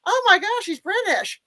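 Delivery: high-pitched retold gasp of discovery; astonished. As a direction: re-enact your amazed realization, pitch jumping up. Retold reaction to learning actor's nationality.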